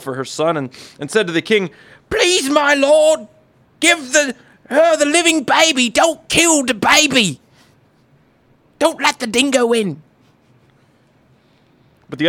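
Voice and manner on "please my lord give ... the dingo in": much higher, louder, slow British plea; pleading mother. Acted. The true mother begging the king to spare her baby. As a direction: beg the king with hammed-up desperation.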